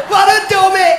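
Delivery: high, loud, laughing yell; laughing shout. Real, not acted. Comic shouting at his own punchline, still laughing. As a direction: crow it out through the laugh.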